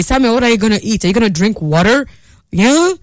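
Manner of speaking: ordinary talk, nothing marked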